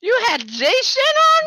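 high, stunned question; can't believe what you heard; shocked disbelief. Real, not acted. Shocked at news.

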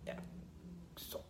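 slow, very soft whisper; whispered promise. Real, not acted. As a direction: hush it to a breath. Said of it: "이렇게 하고".

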